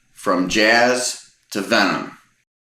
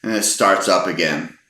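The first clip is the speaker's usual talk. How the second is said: normal talking voice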